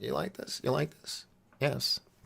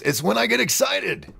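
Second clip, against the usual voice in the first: excited put-on voice; 12 semitones higher, 4 dB louder than usual. Shown as a demonstration. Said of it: "it's my voice".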